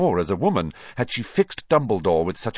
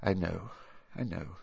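soft, heavy-hearted consolation; 10 dB quieter than usual. A narrator's reading. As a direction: sigh it quietly, weighed down with grief. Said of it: "he said heavily".

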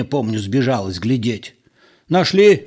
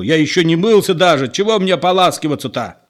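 raised, higher-pitched protest; exasperated hollering; indignant yelling; 6 semitones higher, 4 dB louder, faster than usual. A narrator's reading. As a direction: shout your outrage, speeding up. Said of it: "заорал спокойный Петро".

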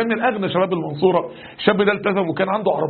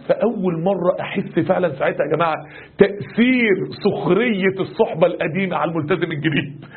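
usual speaking voice

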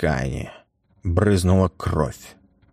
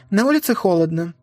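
put-on mother to child; 12 semitones higher, faster than usual. Mother answers son.

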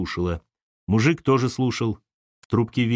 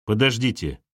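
commanding. Acted. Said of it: "повелительно сказал хозяин дома".

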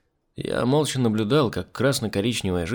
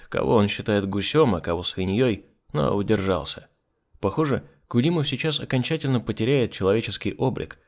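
plain reading voice

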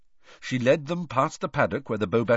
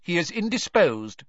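curt; 8 semitones higher, 3 dB louder than usual. A narrator's reading. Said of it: "said Professor Grubbly Plank shortly".

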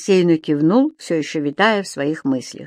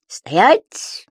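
sharp shout; 3 semitones higher than usual. Acted. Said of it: "закричал господин Дауме".

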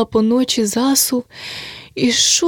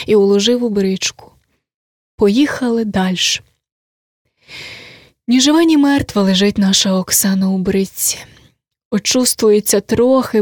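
usual speaking voice

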